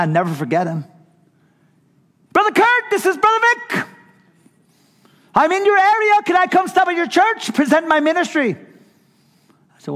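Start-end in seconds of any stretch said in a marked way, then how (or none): 2.3-8.6 very high, loud, eager caller voice; excitable Brother Vic. Retold or imitated. Pastor imitating an excited Brother Vic on the phone. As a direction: gush it like an overexcited Filipino preacher. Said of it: "He was an excitable kind of guy".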